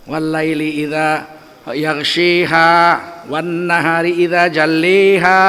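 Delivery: "yaghshiha" and "jalliha" with imala vowels, slow chant; Warsh-style Quran chant with tilted vowels; Warsh recitation. Shown as a demonstration. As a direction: slowly chant the Warsh reading. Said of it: "Nah, ini qiraat Warash".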